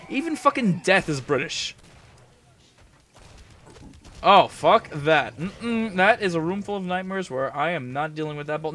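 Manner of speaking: usual speaking voice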